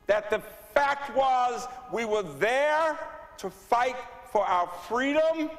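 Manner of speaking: rising, impassioned oratory; swelling with conviction; fervent. Real, not acted. Rising speech about empowerment.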